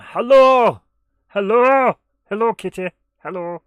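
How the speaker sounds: loud high calls of hello; loud, high calling hello. Pretend. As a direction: call out to the kitty, loud and up high. Calling to the cat.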